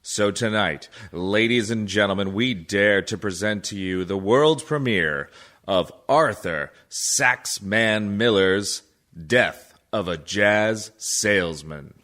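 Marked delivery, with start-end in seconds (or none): none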